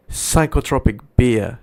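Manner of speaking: normal talking voice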